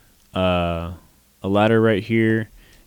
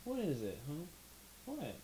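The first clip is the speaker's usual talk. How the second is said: soft high coo to a cat; pet talk; 8 semitones higher, 21 dB quieter than usual. Real, not acted. To the cat on the desk, soft high pet voice. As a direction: sweet-talk the cat quietly.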